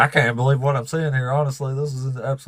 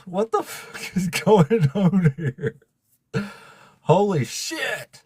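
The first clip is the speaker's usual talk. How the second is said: high, slow, drawn-out amazement; stunned disbelief; 5 semitones higher, much slower than usual. Real, not acted. Streamer floored by gifts pouring in, stunned disbelief. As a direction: stretch every word out in high-pitched shock.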